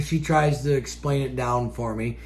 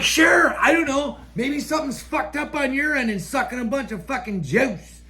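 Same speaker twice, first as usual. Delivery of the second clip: sarcastic retold shout; 10 semitones higher than usual. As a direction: toss it back at her with sarcasm. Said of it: "I'm like".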